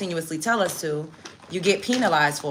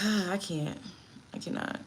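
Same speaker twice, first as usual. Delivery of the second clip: exasperated sigh; 2 semitones lower, 12 dB quieter than usual. Real, not acted.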